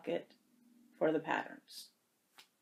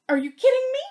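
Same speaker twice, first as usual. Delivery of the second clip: much higher and louder, squealing; squealing disbelief; 6 dB louder, faster than usual. Real, not acted. Vlogger opening Christine's gift, squealing in delight.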